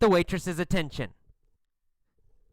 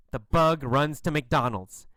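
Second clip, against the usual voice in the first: usual speaking voice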